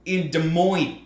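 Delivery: bad Midwest accent drifting toward New York; mock Midwest accent. Host imitates podcasters attempting Midwesterners, mock Midwest accent. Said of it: "'Cause that's what they fucking sounded like".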